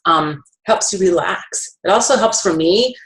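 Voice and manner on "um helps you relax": normal talking voice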